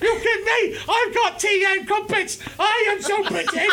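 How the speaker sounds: very high, silly fake British accent; hammed-up caricature British squawk; [name]. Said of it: "his super offensive impression of British people".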